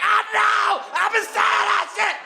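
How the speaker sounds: high, loud, far-voiced yell; excited shout. Bruce Bruce yelling back at a fan, high excited shout. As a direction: shout back in wild agreement.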